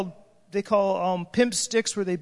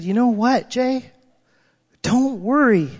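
reassuring friend; 3 semitones higher, 6 dB louder, slower than usual. Retold or imitated. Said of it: "she said".

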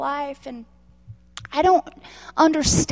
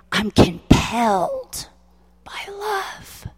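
crying, then breaking into a whisper on love; tearful vow; 8 semitones higher, much slower than usual. Retold or imitated. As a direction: choke up and let the last words fall to a breath. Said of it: "And I said".

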